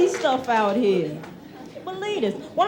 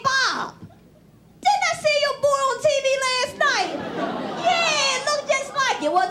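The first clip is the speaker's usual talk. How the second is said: higher, hammed-up proud father; excited dad voice; 7 semitones higher than usual. Father bragging to Bob at the grocery store, much higher. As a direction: brag to the neighbor, pitched up. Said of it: "my father running into somebody".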